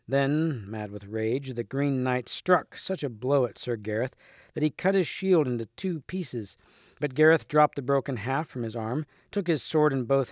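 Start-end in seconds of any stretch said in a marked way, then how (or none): none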